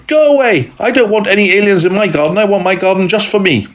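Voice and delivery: put-on high, fast, bossy child voice; rude little boy. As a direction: send the alien away like a spoiled boy. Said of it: "said Henry".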